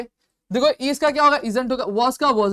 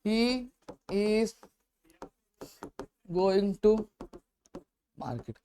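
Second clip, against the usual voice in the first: soft, word-by-word dictation with long gaps; slow dictation; 3 semitones lower, 9 dB quieter, much slower than usual. Shown as a demonstration. Teacher to students, dictating the sentence slowly and softly. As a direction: spell it out slowly as you write. Dictating while writing on board.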